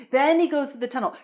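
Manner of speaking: normal talking voice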